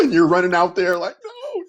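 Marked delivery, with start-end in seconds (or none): 1.2-1.6 mock panic. Running out in panic.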